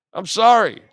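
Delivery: defiant not-really-sorry; loud mock apology. Real, not acted. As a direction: shout a fake apology.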